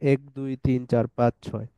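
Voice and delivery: ordinary talk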